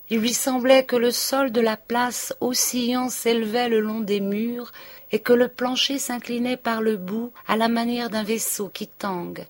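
ordinary delivery

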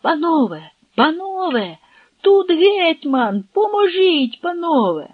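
high, pleading cry for help; anguished high cry, slow on тут гетьман; desperate shout. Acted. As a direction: call the gentlemen to save the hetman. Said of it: "закричав".

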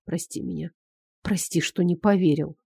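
soft, low apology; hushed, sincere plea; quiet, heartfelt. A narrator's reading. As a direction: ask forgiveness gently and intimately. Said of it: "голос такой тихий, проникновенный".

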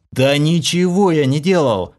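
angry outburst; exploding. A narrator's reading. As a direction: burst out in protest. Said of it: "взорвался я".

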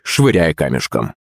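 plain reading voice

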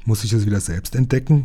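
ordinary delivery

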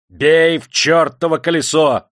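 loud, high, drawn-out shout; furious, stretched yell; shouted order. A narrator's reading. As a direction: bellow the command to strike. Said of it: "закричал Демидов".

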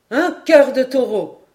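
exclamation ravie, forte et plus haute; enthousiaste. A narrator's reading. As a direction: reprendre l'expression en s'exclamant. Paganel répète, ravi de l'image. (enthousiaste, voix forte)

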